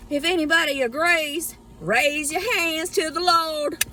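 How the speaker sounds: high revival call, stretching out the end; mock Southern preacher. Asking believers to raise hands, mock Southern preacher.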